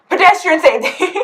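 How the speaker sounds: high, loud slogan with amusement; hammed-up safety announcement; mock campaigning. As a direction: proclaim it like a silly public warning.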